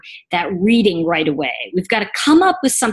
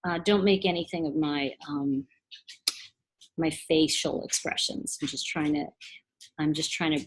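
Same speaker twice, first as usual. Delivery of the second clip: quiet, unhurried request for a moment; hushed reader voice; soft and calm; 5 semitones lower, 9 dB quieter than usual. Shown as a demonstration. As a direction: ask for patience gently. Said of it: "I might say to you".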